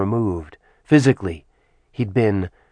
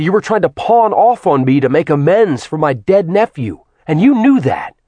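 raised, heated voice, pitched high; furious accusation; 7 semitones higher, 4 dB louder than usual. A narrator's reading. Acheron glares at Artemis and throws her words back, furious. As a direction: fire back angrily, hammering the key words.

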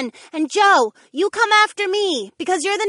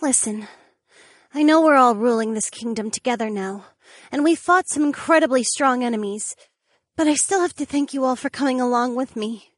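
higher voice, earnest and grateful; higher, sincere thanks to her friends; sincere mermaid. A narrator's reading. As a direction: thank them from the heart.

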